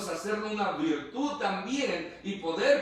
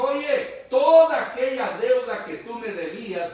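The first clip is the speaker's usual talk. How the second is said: louder, higher stern rebuke; a king scolding his servant, in a raised stern voice; rebuking king; 5 semitones higher, 9 dB louder than usual. Acted. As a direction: call out the ungrateful servant like an angry king. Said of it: "Le dijo".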